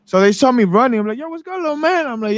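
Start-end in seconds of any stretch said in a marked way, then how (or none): 1.2-2.1 put-on high, soft greeting to a kid; older gang member. Gang member greets the running boy, lightly voiced. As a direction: call out to the little guy, friendly.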